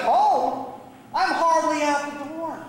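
high, scoffing disbelief; incredulous. Acted. Younger brother answering the plea to come home, incredulous. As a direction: scoff at the very idea.

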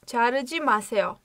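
자르지 마세요 said fast and lightly; quick repeat. Shown as a demonstration. Teacher running the phrase at speed for learners. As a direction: rattle off 자르지 마세요 as a fluent run. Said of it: "Fiilimiz burada".

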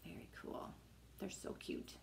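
soft breathy aside; whispering. Real, not acted. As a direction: whisper it under your breath. Hushed asides near sleeping child.

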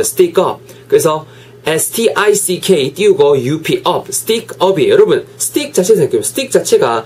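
normal talking voice

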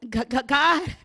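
normal talking voice

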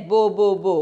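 stuck repeating the first syllable of the name; stammering. A narrator's reading. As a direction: stutter on "Bo", unable to get past it. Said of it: "She began to stammer".

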